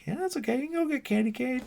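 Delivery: high, teasing mock voice; imitated Tully. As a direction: tease in a squeaky put-on voice. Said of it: "Tully kinda laughs, like".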